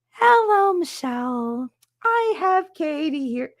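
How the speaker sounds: Nurse Helen's voice. Retold or imitated. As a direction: greet sweetly like a calm school nurse. Nurse Helen phoning the mom.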